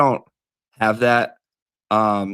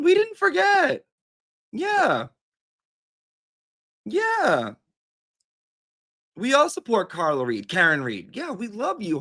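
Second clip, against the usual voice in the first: effusive reassurance; 10 semitones higher than usual. Pretend. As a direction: soothe an upset friend over the phone, pitched way up. Soothing upset Kat on the phone.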